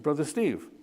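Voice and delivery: ordinary talk, nothing marked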